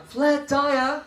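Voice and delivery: teacher call. Shown as a demonstration. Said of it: "the one I like".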